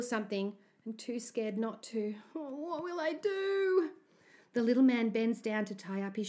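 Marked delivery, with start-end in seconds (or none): 2.3-3.9 high, drawn-out anxious wail; helpless despair. A narrator's reading. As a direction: wail it slowly, at a loss. Scared boy is torn.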